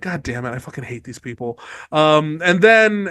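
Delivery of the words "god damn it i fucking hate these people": much lower and softer, under the breath; muttered exasperation. Retold or imitated. Catelyn despairing at the lords, soft muttered complaint. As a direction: grumble it aside, fed up. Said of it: "Catelyn's like".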